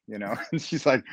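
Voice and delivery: ordinary talk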